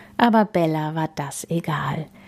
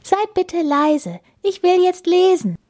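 put-on high girl's voice, building louder; insistent Bella; 13 semitones higher, 4 dB louder than usual. Bella shooing away the penguins, insistent.

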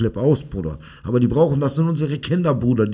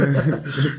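short laugh breaking into the talk; amused laugh. Real, not acted. Coach recalling kids he must not laugh along with, amused laugh. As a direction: let a little laugh slip out.

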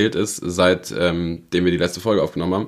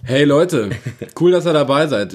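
mock hype intro; 5 semitones higher, 3 dB louder than usual. Mock upbeat podcast greeting.

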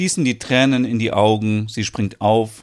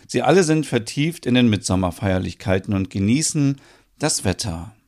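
usual speaking voice

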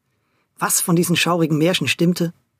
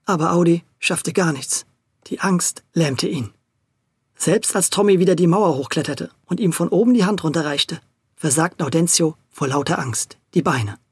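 plain reading voice

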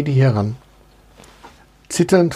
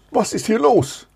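higher-pitched questioning voice; princess demanding answers; 8 semitones higher than usual. A narrator's reading. Princess arriving on the scene, demanding explanation. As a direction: ask it high, expecting an answer at once.

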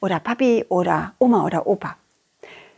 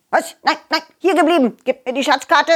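put-on quick, higher bossy old man; demanding neighbour; 5 semitones higher than usual. Neighbour orders the children to stay and hand over the map, higher and fast. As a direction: snap at the kids to hand it over.

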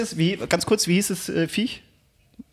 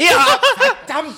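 loud, slow, laughing curse; laughing frustrated shout; 5 dB louder, much slower than usual. Real, not acted. Missed quiz answer, laughing frustration.

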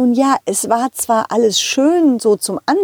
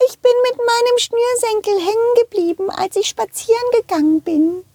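much higher squeaky shoe voice; talking shoe voice; 12 semitones higher than usual. Acted. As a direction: speak as a small shoe. Shoe character speaks.

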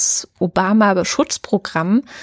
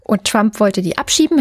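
normal talking voice

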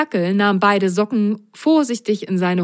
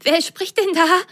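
high, surprised sock voice; startled by a strange voice; 11 semitones higher than usual. Acted. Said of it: "fragte Pitsch". (erschrockene hohe Stimme)

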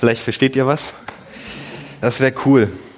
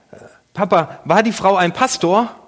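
put-on higher, small child's voice asking; imitating young son; 6 semitones higher than usual. Son calling to his dad from the back seat, higher child voice.

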